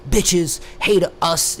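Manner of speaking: a hint of a resentful loner sneering; mocking incel voice. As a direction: lightly caricature a bitter incel. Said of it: "He started saying some incel shit".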